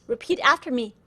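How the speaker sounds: usual speaking voice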